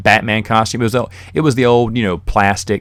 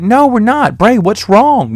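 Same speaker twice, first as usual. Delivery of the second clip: high, louder hammed-up denial; mock-innocent kid denying it to dad; fake innocence; 13 semitones higher, 6 dB louder, slower than usual. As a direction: protest innocence, pitch high. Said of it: "totally faking it".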